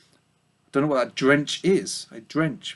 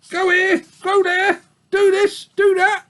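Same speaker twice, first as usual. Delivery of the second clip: very high whiny sheep mocking the orders; singsong the nagging orders sourly; old ewe mimicking wolves' nagging; complaining old ewe; 4 dB louder than usual. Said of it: "hoarse, complaining voice".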